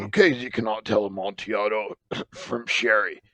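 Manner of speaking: scornful, tipsy dismissal; scoffing drunkard. Acted. Drunken Fortunato mocking Lucchese, scornful and tipsy. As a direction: wave the rival off.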